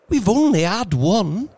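high, loud, excited; rapid reply. A narrator's reading. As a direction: press the point eagerly. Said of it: "she replied rapidly".

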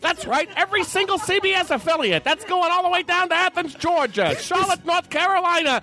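put-on old-time radio announcer. Reporter hyping the winner for listeners, brisk old-time radio patter. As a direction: gush like a 1938 CBS newsman. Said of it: "they talk like this the whole goddamn time".

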